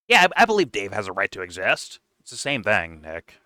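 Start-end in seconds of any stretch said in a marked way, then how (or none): 0.1-2.0 louder put-on quote; mock talking-point parroting. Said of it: "regular ass people that repeat those talking points".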